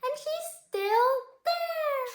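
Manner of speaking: high, drawn-out cub cry; slow, high-pitched baby bear voice; squeaky Baby Bear. A narrator's reading. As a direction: squeal it slowly as the little bear. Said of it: "cried Baby Bear".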